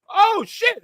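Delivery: very high mock shock; surprised reaction. Winner realizing he won, shocked. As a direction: squeal it in surprise.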